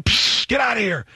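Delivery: high, loud hiss and shoo; loud shoo into the dark; shooing shout. Retold or imitated. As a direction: scare off the animal. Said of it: "So I'm going".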